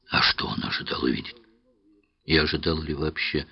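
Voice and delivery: usual speaking voice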